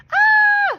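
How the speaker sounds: piercing high sustained scream; waitress screaming with a squirrel in her hair. A narrator's reading. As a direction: scream in panic. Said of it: "screamed Rita".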